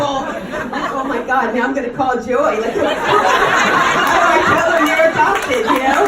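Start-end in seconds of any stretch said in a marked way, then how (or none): 0.9-2.6 laughing through a mock panic; half-laughing, a little louder. Retelling her panic, laughing. 2.6-6.0 laughing, slow and loud. Real, not acted. Laughs while telling the story.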